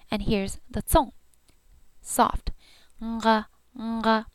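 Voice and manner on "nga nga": nga starting on an ng sound; nasal nga. Shown as a demonstration. Teacher modelling the nasal nga for students. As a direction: hum ng just before the nga. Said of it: "There's a ng sound right before it".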